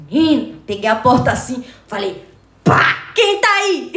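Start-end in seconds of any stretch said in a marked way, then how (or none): 2.6-3.9 slow, loud, higher call at the door. Retold or imitated. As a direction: challenge whoever is behind the door. Calling out at the door. (chamando alto e devagar: quem tá aí?)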